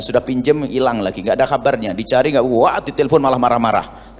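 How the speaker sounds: ordinary talk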